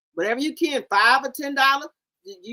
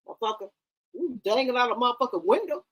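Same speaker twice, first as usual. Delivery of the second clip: normal talking voice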